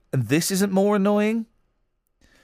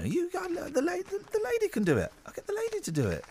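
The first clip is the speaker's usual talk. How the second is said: squeaky high whine; 13 semitones higher, 7 dB quieter than usual. Retold or imitated.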